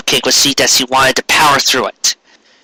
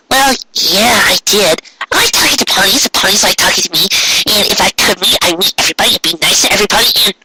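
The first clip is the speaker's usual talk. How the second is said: very high bubbly voice, rushing faster and faster; Pinkie Pie; 7 semitones higher, faster than usual. A narrator's reading. Pinkie telling Cupcake she loves talking to ponies, high and rushing. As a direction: gush happily about meeting everyone.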